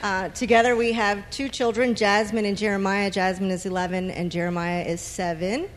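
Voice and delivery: ordinary talk, nothing marked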